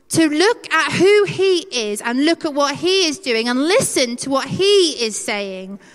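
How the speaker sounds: usual speaking voice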